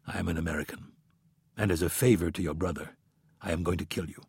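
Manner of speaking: low, quiet, cold killer voice; calm menace, very quiet; cold assassin. A narrator's reading. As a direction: assassin speaks to his victim, quietly.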